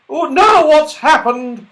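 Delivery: high, loud, exasperated outcry; annoyed. A narrator's reading. Dame Washalot to everyone after the landing, annoyed. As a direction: squawk it out, thoroughly put out. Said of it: "in a most annoyed vo-, voice".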